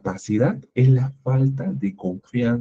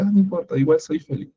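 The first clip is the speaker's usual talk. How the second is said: a high, oddly chirpy reply to bad news; creepy cheerfulness; 6 semitones higher than usual. Teacher mocking an always-happy student who hears his dog died, high chirpy. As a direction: brush off the sad news with a too-bright lilt. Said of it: "Eso ya da miedo".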